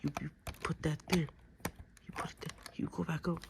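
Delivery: ordinary talk, nothing marked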